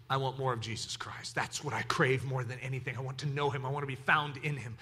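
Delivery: low, hushed devotion; intimate, subdued declaration of longing; quiet earnest confession. Acted. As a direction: drop right down, softly and sincerely. Earnest answer when pushed.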